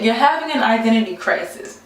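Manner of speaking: ordinary talk, nothing marked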